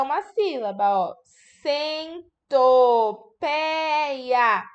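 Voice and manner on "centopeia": Centopeia pulled apart, each syllable held long; very slow syllable-by-syllable. Shown as a demonstration. Teacher sounding out a word for children, very slowly. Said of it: "Tá faltando um pedacinho".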